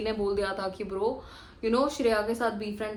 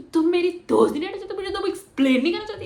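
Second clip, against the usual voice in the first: fast, high, loud mock screech; retold tantrum, much higher and louder, rushed; imitated screaming; 8 semitones higher, 5 dB louder, much faster than usual. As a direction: rattle it off like a furious friend. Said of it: "she's screaming".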